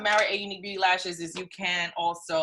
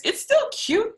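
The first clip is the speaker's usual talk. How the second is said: much higher cute squeal; 5 dB louder than usual. Real, not acted. Squeal of approval.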